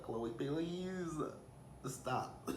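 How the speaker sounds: drawn-out high "please", then a hushed "stop"; mock-exasperated pleading. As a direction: beg a friend to quit, half playful. Exasperated plea to Khloe retold.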